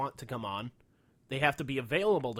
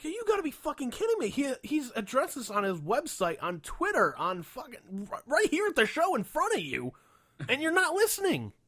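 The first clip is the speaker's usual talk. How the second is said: much higher, incredulous outburst; can't believe the crowd; fed-up rant at a clueless fan; exasperated rant; 11 semitones higher, 3 dB louder than usual. Retold or imitated. Exasperated retort to heckler.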